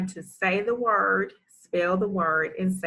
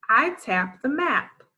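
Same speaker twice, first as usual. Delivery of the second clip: fluent read; 6 semitones higher, 3 dB louder than usual. Shown as a demonstration. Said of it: "Let's read".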